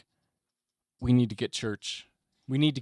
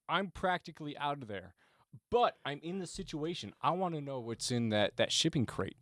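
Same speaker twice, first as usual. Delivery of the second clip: ordinary talk, nothing marked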